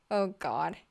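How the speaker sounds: ordinary delivery, nothing marked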